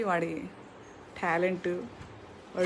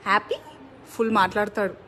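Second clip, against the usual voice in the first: ordinary delivery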